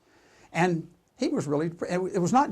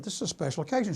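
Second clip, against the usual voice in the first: ordinary delivery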